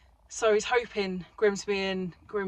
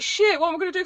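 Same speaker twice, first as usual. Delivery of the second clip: panicked; 10 semitones higher, 9 dB louder, faster than usual. Retold or imitated. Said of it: "I was like".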